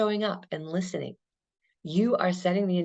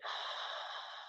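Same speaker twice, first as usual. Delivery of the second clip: audible exhale; 17 dB quieter than usual. Shown as a demonstration. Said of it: "breathe out".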